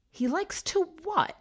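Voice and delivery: high, shocked rising question; appalled disbelief; horrified question. A narrator's reading. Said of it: "she asked, horrified".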